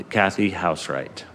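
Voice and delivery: ordinary delivery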